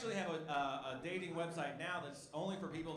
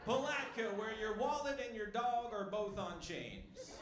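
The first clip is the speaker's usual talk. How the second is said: higher, slow tourism-ad voice; mock slogan; 4 semitones higher, slower than usual. Comic mocks the town with a fake slogan, slow ad voice. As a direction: sell the town like a cheesy ad.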